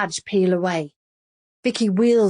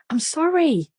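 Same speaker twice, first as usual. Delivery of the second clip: tearful plea; 10 semitones higher than usual. A narrator's reading. Vicky pleading.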